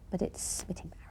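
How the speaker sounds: whispered, embarrassed; whispered quote. Retold or imitated. Daughter hushing mum on the field trip, whispered. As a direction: whisper it so no one hears.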